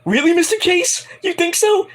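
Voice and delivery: very high squeaky hopeful voice; mock a giddy teen girl; high hammed-up girly question. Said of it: "she's like".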